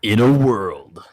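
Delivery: movie trailer voice. Pretend. Movie trailer narrator parody.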